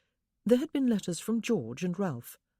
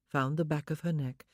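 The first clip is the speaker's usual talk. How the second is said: ordinary delivery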